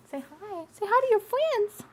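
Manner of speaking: very high cooing pet voice. Real, not acted. Talking to her cat.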